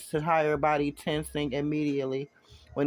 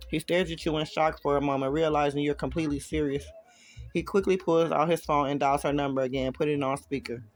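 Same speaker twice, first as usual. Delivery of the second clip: ordinary delivery, nothing marked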